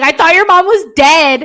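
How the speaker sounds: imitating a shocked guy's outburst; flabbergasted. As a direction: blurt it out in total shock. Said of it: "he is just flabbergasted".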